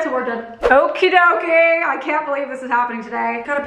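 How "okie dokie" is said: higher, louder, drawn-out singsong; playful. Real, not acted. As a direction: sing it out playfully. Cheerful sign-off to work.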